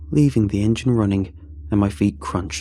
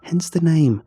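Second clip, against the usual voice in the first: higher voice, mild exasperation; exasperated friend; 7 semitones higher than usual. Acted. Friend explaining the spindly man to Alex, mildly exasperated. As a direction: explain it with a touch of impatience.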